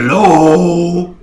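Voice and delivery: loud ringing fairy call. Pretend. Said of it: "that's my friend's voice".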